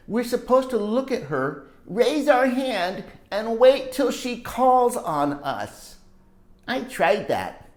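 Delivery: usual speaking voice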